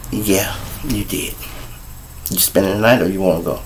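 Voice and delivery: low, soft, unbothered; cold quiet. Retold or imitated. Him to baby mama after the slap, low and cool. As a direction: calmly ask it, very soft.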